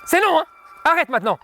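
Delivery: stern shout at a misbehaving dog; firm scolding shout. Real, not acted. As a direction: shout the dog down, hard and sharp. Trainer stops dog attacking someone.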